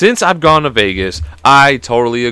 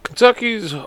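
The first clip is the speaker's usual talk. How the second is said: usual speaking voice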